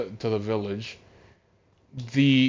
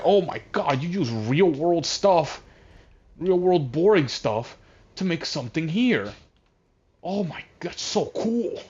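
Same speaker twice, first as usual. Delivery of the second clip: higher-pitched, hammed-up amazement; amazed Steve; 6 semitones higher than usual. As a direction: gush in mock awe, stretching the words. Acting out Steve's amazed reaction.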